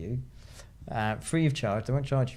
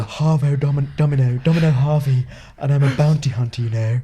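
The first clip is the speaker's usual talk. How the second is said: loud, badly faked English actress accent; hammed-up film heroine introducing herself; Keira Knightley; 7 dB louder than usual. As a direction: do a bad impression, projecting loudly. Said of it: "That's your Keira Knightley impression".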